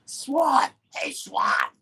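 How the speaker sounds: high strained call to a friend; Sammy calling out. Retold or imitated. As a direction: call out high, voice thin and hushed. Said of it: "freaking my man Sammy's like".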